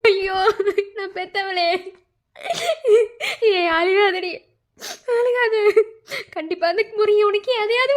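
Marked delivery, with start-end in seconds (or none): 0.0-5.5 crying while consoling. Acted. Mother to her crying daughter, weeping consolation.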